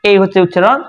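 normal talking voice

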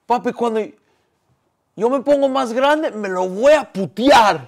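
put-on high, loud, drawn-out boy's vow; a little kid loudly swearing revenge for dad; fired-up young son. Son vows to beat the rival's kid, emotional high shout. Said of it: "es emoción porque es mi papá".

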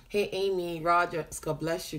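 ordinary talk

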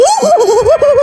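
sharp, very high, loud gasp; shrieking gasp. Real, not acted. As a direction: yelp of sudden fright. Sudden scare in game.

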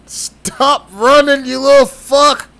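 loud slow furious yell; angry shout. Real, not acted. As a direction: bellow at the runaway. Chasing enemy, yelling.

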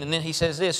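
normal talking voice

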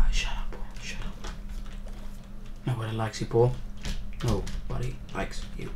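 a hushed, mocking aside; teasing whisper. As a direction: whisper it at Paul to tease him.

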